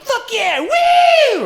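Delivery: high, loud hoons cheering; rowdy shout. Retold or imitated. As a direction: whoop it up like lads in a car. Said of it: "they're all just like".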